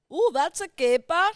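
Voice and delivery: put-on hammy Goldmember Dutch accent. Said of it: "I know that's Goldmember".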